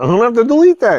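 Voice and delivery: high, loud flustered protest; flustered exclamation. Real, not acted. Child swore on camera, flustered high exclamation. Adult caught out by child's swearing.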